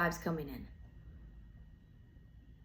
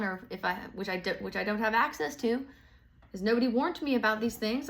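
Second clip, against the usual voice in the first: usual speaking voice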